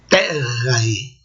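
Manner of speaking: "Terrae" with long, stressed first syllable; classical pronunciation. Shown as a demonstration. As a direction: draw out the start of "Terrae", TEER-ra-e, low. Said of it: "a vogal longa é antes".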